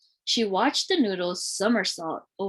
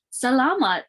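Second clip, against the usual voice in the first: higher, louder grateful exclamation; cried out; 5 semitones higher, 4 dB louder than usual. A narrator's reading. Cora crying out thanks in Tagalog, higher and louder. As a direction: call out thanks with joy. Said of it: "she cried out".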